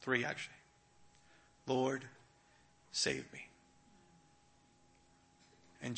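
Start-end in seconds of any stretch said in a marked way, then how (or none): 1.7-3.5 mild plea. Retold or imitated. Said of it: "And he cries".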